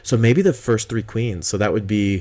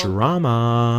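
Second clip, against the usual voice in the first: mildly hammed-up, stretched drama; playful drawn-out reveal; mock dramatic; 2 semitones higher than usual. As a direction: draw out the word theatrically. Running gag repeated on next count.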